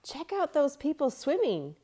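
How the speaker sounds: high, loud and eager; excited girl. A narrator's reading. Girl showing friends the swimmers, high and eager. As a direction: call friends to look. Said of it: "Sue flapped her arms".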